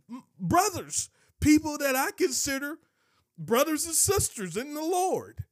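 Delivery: loud, high preacher's cry; fiery pulpit delivery, rising; preaching at full force. Real, not acted. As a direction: preach it out to the whole church.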